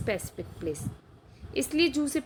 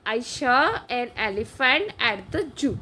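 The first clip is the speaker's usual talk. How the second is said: slow model sentence; much slower than usual. Shown as a demonstration. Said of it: "ये इसका correct हो जाएगा".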